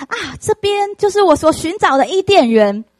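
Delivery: high, loud cry of wonder; amazed cry. Acted. Son discovering Eden exclaims in amazement, high and loud. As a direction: gasp it out as if you've found paradise. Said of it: "他好惊讶".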